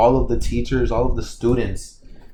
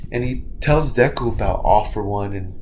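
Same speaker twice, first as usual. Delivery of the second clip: usual speaking voice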